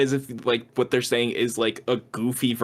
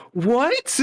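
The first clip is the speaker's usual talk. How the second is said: high-pitched wounded disbelief; mock hurt; 11 semitones higher than usual. Imitating Joker breaking character at Puddles, hurt. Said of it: "He's, like, genuinely hurt".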